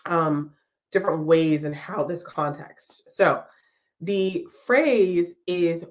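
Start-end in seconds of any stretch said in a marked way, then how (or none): none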